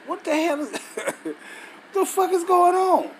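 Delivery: high, loud cry of disbelief with a laugh breaking in; shocked disbelief. Real, not acted. Preacher shocked at a wrong verse, high and loud disbelief. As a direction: blurt it out, baffled the verse is wrong.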